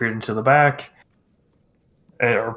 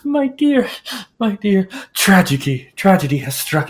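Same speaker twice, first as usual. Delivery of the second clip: high, theatrical gasping lament; dramatic seamstress; 8 semitones higher, 3 dB louder than usual. Acted. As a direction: wail it like a diva.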